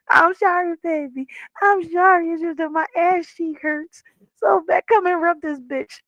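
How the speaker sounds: hammed-up baby-talk apology over a sore cheek; whiny pleading. Playing up the pain to her fiance, mock whiny.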